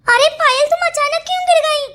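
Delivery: urgent, worried exclamation; alarmed. Acted. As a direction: cry out in shocked concern. Alarmed at friend's fall.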